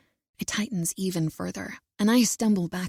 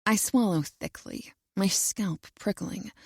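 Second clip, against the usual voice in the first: ordinary delivery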